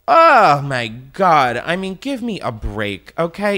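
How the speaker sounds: loud, high burst of exasperation; exasperated. Real, not acted. Fed up with dating games on air, exasperated. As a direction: explode on the first word, then drop back. Exasperated outburst in timed rant.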